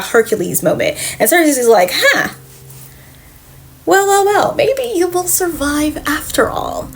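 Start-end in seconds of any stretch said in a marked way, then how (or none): 1.9-6.9 high, airy, musing witch voice; intrigued witch. Retold or imitated. Circe to the baby who threw a snake, intrigued. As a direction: muse aloud high up, trailing into near-whisper.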